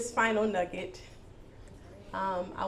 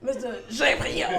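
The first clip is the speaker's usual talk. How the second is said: name said in a playful French accent, a touch louder; 3 semitones higher, 6 dB louder than usual. Shown as a demonstration. Host naming her accompanist in a French accent. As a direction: give the name a hammed-up French lilt. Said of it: "do you care if I use the accent?".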